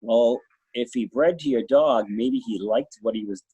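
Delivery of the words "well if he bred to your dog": usual speaking voice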